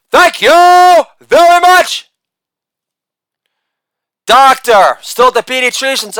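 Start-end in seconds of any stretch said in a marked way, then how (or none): 0.1-2.1 high, slow [name] thank you. Acted. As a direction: drawl it like [name]. [name]-style sign-off.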